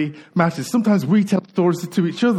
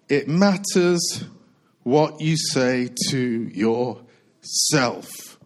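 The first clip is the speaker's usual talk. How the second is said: very slow, dropping lower toward the end; slow emphasis; 5 semitones lower, much slower than usual. Shown as a demonstration. Preacher stating what he wants to say today, slow and deliberate. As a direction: leave space round each word of the message. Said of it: "what I want to say today is".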